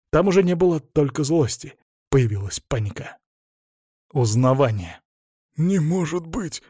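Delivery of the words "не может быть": slow, higher gasp of disbelief; breathed out in disbelief. A narrator's reading. Cornered man recognises his old friend, breathed out in disbelief. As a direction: let it out slowly on an exhale. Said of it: "выдохнул он".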